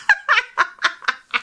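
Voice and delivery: high, helpless laughter; laughing. Real, not acted. Laughing at the claim of being an actress, laughing. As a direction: burst out laughing at yourself.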